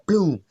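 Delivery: punchy mock gunshot "boom"; imitated gunshot. Preacher sounding out gunshots in the building, imitated bang. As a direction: burst it out like a shot. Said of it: "Gunshot go off".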